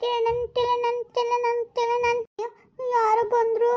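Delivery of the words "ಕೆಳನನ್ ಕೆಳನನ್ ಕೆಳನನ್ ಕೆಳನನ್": higher softer sung ding-dong; sung doorbell chime. Acted. Wife mimicking the doorbell as a guest arrives. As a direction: sing the doorbell ringing.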